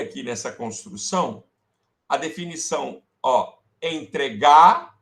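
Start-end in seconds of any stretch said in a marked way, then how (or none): none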